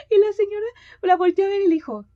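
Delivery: fast, high, loud while laughing; laughing narration. Real, not acted. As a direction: tell it through real laughter.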